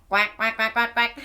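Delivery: low, dejected little duck quacks; mama duck quacks. Pretend. Mama duck quacking between sung verses.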